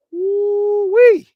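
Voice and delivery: long, high, loud woo-wee; rising high whoop of excitement; excited whoop. Real, not acted. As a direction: let out a drawn-out high whoop. Host whoops about show topics.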